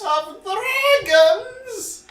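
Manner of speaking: high squeaky sing-song echo. Acted. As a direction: squeal the title back. Echoing the intro title.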